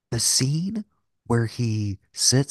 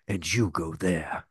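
slow, lightly put-on general giving orders; mock battle-plan voice; put-on Napoleon voice; 3 semitones lower, slower than usual. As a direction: play a hint of a commanding Napoleon, slowly. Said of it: "I don't know why I'm doing this voice".

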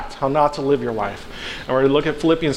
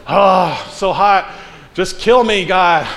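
melodramatic moan, higher and louder; dramatic Jonah; 3 semitones higher, 4 dB louder, slower than usual. Retold or imitated. Preacher mocking Jonah's over-dramatic complaint to God, higher and louder. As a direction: overplay it like a self-pitying diva in the heat. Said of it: "so dramatic".

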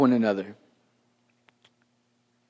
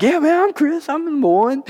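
much higher, put-on eager voice; mock eager; 10 semitones higher than usual. Pastor mocking himself as the supposed helper, high and mock-eager. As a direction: play the wrong Chris in a high voice.

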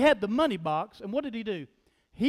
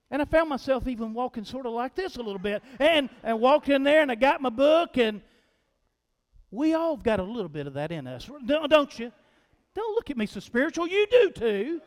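normal talking voice

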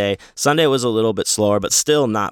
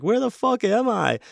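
much higher, giddy and bewildered; high-pitched mock stoner asking where he is; giggly lost stoner. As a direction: squeak it like a baked friend who is totally lost. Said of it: "he starts just giggling even more".

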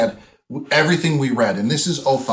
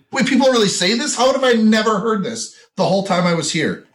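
put-on higher and fast, stunned Wisconsin accent question; shocked disbelief; 10 semitones higher, 3 dB louder than usual. Hearing a bank teller's Wisconsin accent, shocked higher and fast. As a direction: sound amazed you never noticed the Wisconsin accent. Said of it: "And I'm like".

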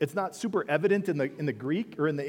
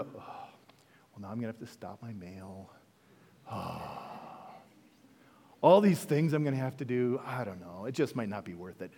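mumbled, softer sulky complaining; grumbling reluctant winner; 6 semitones lower, 4 dB quieter than usual. Acted. Sermon's reluctant prize winner, muttering, softer and sulky. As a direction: grumble like it's a chore to win. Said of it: "or are you the".

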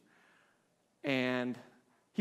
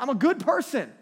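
loud, high-pitched mock protest; hammed-up protest of one's own goodness; mock self-assured; 8 semitones higher, 8 dB louder than usual. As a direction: insist on it, sending up the self-deceived. Voicing a self-deceived objector.

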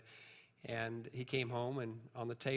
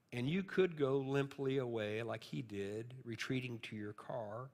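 usual speaking voice

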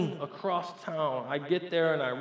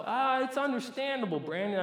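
high, placating listener voice; lightly acted, high-pitched reassurance; excusing listener; 10 semitones higher than usual. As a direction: let him off the hook in a lifted voice. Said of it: "you say to yourself".

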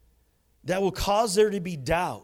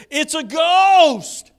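high, slow, loud cry of terror; frightened cry; 10 semitones higher, 9 dB louder, much slower than usual. Retold or imitated. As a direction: shriek it like you see a ghost. Disciples cry out in fear.

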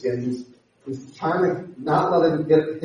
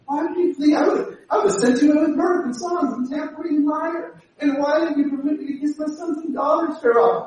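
Laban pleading; 9 semitones higher than usual. Retold or imitated. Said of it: "every line is a different emotion".

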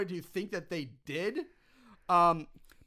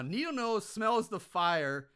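ordinary talk, nothing marked

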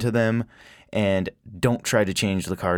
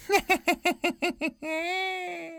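high, drawn-out cackle; high-pitched goofy laugh; silly cackle; 4 dB quieter than usual. Real, not acted. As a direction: cackle away at your own joke. Cackle at own wordplay.

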